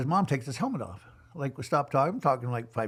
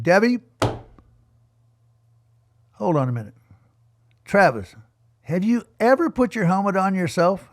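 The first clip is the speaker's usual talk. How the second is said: raised, louder voice calling across the track; calling out firmly; 4 semitones higher, 8 dB louder than usual. Retold or imitated. Coach calling over the mom and the rider, loud and firm. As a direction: stop the mom and call the kid over.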